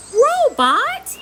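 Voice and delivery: shout. Acted. Ben shouting at the robot to put the elf down. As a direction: yell it to stop him. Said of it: "shouts Ben".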